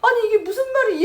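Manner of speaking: high, loud and tearful, leaning on 말이; tearful protest. Retold or imitated. Mother to husband, a high tearful protest. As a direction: cry out 이게 무슨 말이 in disbelief.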